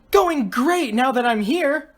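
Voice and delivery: high, drawn-out, eager voice; excited cry. A narrator's reading. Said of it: "cried Grace".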